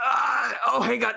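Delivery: high yelled alarm; shouting. Pretend. Shouting as Kyle.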